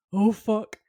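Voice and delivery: loud, comic oh-no reaction; hammed-up dread; mock alarm. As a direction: play up a stunned swear, loud. Voicing Sam's alarmed face.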